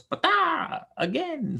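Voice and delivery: put-on very high little-kid babble; toddler demanding a story. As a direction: mimic a small child's squeal. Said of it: "I finish the book and he goes".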